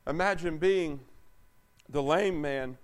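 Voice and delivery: usual speaking voice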